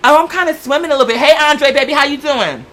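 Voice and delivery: high, quick, flirty sing-song; fluttering as if the shirt fit loose; flirty self-quote. Retold or imitated. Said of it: "I'm like".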